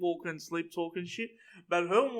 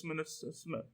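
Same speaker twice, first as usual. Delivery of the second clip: sleep-talker; 2 semitones lower, 12 dB quieter, much faster than usual. Retold or imitated. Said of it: "She's just sleepwalking".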